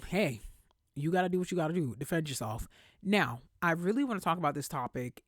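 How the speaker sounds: normal talking voice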